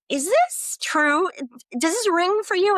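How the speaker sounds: high, emphatic retold questioning; probing question. Practitioner checking a hunch with a client, high probing question.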